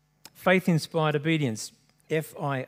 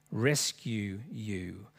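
slow, soft and lower; solemn plea; 5 semitones lower, 9 dB quieter, much slower than usual. A narrator's reading. King to Daniel at the den, solemn plea. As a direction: draw it out gravely and softly.

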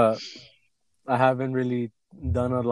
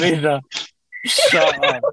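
laughing shout; 3 semitones higher, 6 dB louder, slower than usual. Real, not acted. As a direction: yell in mock outrage. Reacting to a bad joke.

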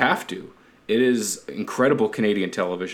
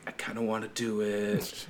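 softer, put-on voice; sly mock aside; 10 dB quieter than usual. Host voicing greedy next-generation family, hushed mock admission. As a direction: say it as a hushed confession.

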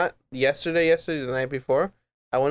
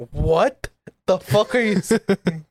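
confused; 4 semitones higher, slower than usual. Retold or imitated. Confused reply to gibberish.

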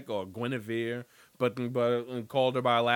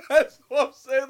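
higher and louder, still laughing; laughing insistence; 10 semitones higher, 9 dB louder than usual. Real, not acted. Host insisting on his point to listeners, laughing. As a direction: push through the laugh.

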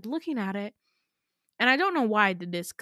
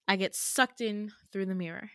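ordinary delivery

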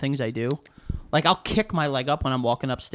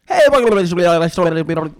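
podcast intro; 2 semitones higher, 8 dB louder, faster than usual. Retold or imitated. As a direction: rattle off an intro like an eager host. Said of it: "I do intros really fast".

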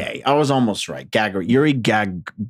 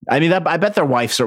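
usual speaking voice

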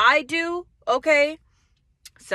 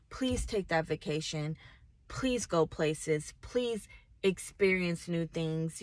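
softer, slower heartfelt pleading; sincere plea; 3 semitones lower, 6 dB quieter than usual. Real, not acted. Vlogger softly urging followers to take a vacation. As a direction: gently beg them to enjoy life.

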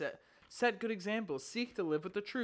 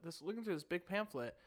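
ordinary talk

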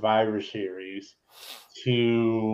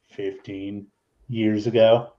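ordinary talk

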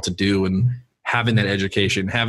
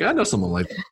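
laughing joke; 6 semitones higher than usual. Retold or imitated. Joking quote while laughing.

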